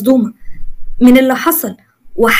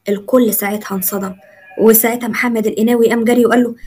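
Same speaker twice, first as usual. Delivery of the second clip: ordinary delivery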